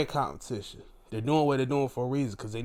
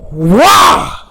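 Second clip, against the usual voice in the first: loud, blown-away outburst; booming astonishment; shocked exclamation; 5 semitones higher, 18 dB louder than usual. Retold or imitated. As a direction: blast it out in amazement. Acts out shock at title change.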